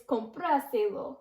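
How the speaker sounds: "compraselo" with the "pra" stressed. Shown as a demonstration. Teacher showing where the extra accent falls, stressed A. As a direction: lean on the A in "compraselo". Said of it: "extra accent right on that A".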